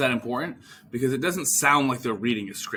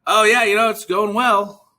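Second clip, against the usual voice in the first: canned response; 9 semitones higher, 9 dB louder than usual. Shown as a demonstration. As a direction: rattle off a rehearsed answer. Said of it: "this canned response of".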